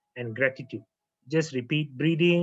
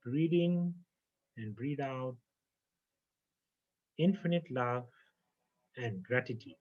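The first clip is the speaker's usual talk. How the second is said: guided meditation. Acted. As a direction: breathe them down into calm, one phrase at a time. Leads a guided breathing meditation.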